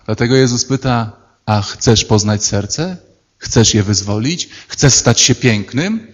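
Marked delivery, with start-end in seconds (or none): 1.5-6.1 soft, slow, low invitation; gentle inviting question. Retold or imitated. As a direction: ask tenderly, drawing them in. Jesus asks gently. (łagodne, ciche, powolne pytanie)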